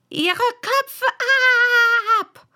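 very high, loud royal command; imperious shrieked order. A narrator's reading. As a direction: shriek the sentence, stretching the last word. Queen orders a beheading.

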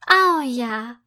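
put-on girl cheering to her friend; joyful cry. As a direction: cheer at the idea. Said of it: "ruft sie voller Freude".

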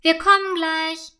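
high, sing-out reply; calling back. A narrator's reading. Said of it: "rufen die beiden Freundinnen zurück". (zurückrufend, mit höherer Stimme)

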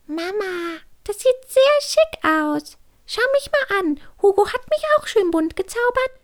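put-on very high, squeaky child-chick voice; little chick soothing. A narrator's reading. Chick tries to calm her mother, squeaky and coaxing. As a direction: coax mum in a tiny high voice. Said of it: "Küken Klara versuchte sie zu beruhigen".

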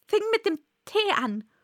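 high, nervous Hatter voice; ängstlicher Hutmacher. Acted. Trembling Hatter answers the King. (ängstlich, mit hoher Stimme)

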